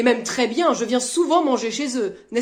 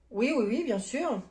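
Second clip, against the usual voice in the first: normal talking voice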